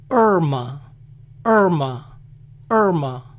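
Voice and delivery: "Irma" as "ER-muh"; American "Irma", opening on the R; English pronunciation, I omitted. Shown as a demonstration. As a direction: say "Irma" without any I sound. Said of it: "omiten el sonido I".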